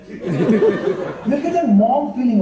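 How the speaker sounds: ordinary talk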